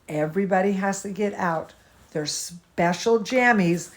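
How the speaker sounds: ordinary delivery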